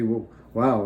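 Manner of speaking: ordinary delivery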